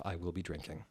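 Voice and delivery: ordinary talk, nothing marked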